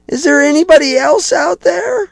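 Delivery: high, loud punchline yell; desperate shout. Acted. Punchline, man calls out again.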